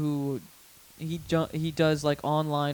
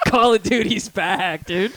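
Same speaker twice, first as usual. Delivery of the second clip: louder, drawn-out, higher exclamation; delighted; 4 semitones higher, 4 dB louder, slower than usual. Retold or imitated. Gamer thrilled a kid insulted him, loudly delighted. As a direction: stretch it out with gleeful triumph. Repeats his reaction, now delighted.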